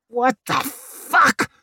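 high, furious snarl. Acted. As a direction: spit the words out in rage. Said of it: "snarled Leo".

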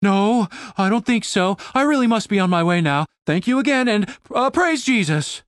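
lightly put-on voice, hurrying to leave; nervous disguised voice, pitched a bit up. A narrator's reading. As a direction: excuse yourself awkwardly in disguise. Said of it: "she continued in her disguised voice".

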